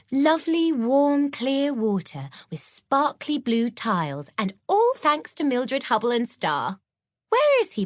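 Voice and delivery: high, eager schoolgirl voice; Maud's voice. Acted. Maud chatting with Mildred on the train, high and eager.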